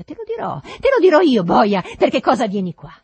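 high, loud, furious outburst; enraged shout. A narrator's reading. Master tells servant why he came, enraged shout. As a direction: shout it in a rage. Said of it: "gridai tremando di rabbia".

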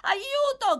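put-on very high, loud boy's yell; desperate cry for help. A narrator's reading. Oliver struggles to break free, shouting desperately. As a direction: yell for rescue at the top. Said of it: "gridò Oliver".

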